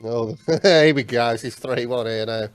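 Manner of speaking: usual speaking voice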